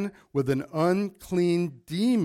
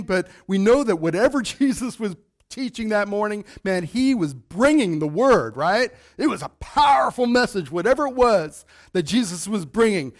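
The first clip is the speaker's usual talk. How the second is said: higher, louder preaching at full force; 5 semitones higher, 4 dB louder than usual. Real, not acted. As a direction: build the sermon up, driving the point home.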